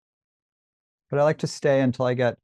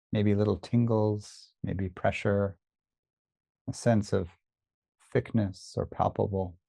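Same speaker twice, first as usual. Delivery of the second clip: unhurried body-scan guidance with long pauses; guided meditation. Real, not acted. Teacher leads listeners through a body scan, calm meditation voice. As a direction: guide attention gently, leaving space after each phrase.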